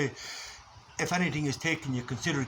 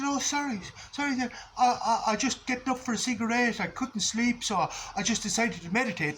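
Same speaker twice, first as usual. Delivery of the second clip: meek flatmate excuse; 7 semitones higher than usual. Retold or imitated. Flatmate caught meditating at night, sheepish and higher.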